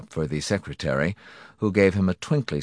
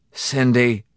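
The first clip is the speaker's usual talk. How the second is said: raised, loud, falling call; miserable call; 6 semitones higher, 5 dB louder than usual. A narrator's reading. Husband calling to his captive wife, miserably. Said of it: "Morrison called miserably".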